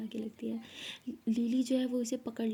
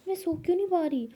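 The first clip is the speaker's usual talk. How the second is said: frustrated outburst; 7 semitones higher, 5 dB louder than usual. Acted. Lakshmi can't sleep, frustrated.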